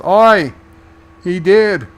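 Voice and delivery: a shouted Aussie "Oi!", lightly hammed; playful Australian bloke impression; loud, high Australian accent, done for a laugh; mock Australian accent. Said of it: "Australian accent".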